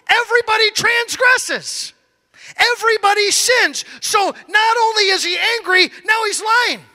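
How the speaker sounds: ordinary talk, nothing marked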